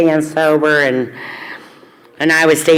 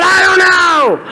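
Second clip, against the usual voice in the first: high, loud, exasperated shout; helpless high yell at her bunkmate; yelled answer; 13 semitones higher, 4 dB louder, slower than usual. Retold or imitated. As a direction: blurt it out at the top of your voice. Retelling her answer in the fight.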